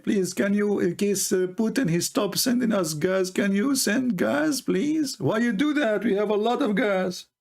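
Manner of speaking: mocking a pleading president; mock begging. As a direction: play a desperate beggar. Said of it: "he start begging the Qatari".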